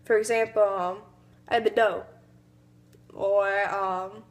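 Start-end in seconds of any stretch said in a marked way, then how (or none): 1.5-2.0 Southern "door" cut to "dough"; working-class Southern accent. Shown as a demonstration. Student demonstrating working-class Southern accent. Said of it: "they would just say the R".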